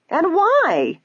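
very high, indignant challenge; shrill it out in offended pride; haughty shrill. A narrator's reading. Ada refuses her sister's urging to leave, haughty shrill. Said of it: "her haughty sister shrilled".

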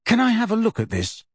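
high, eager request. A narrator's reading. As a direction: ask keenly. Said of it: "Harry asked Luna eagerly".

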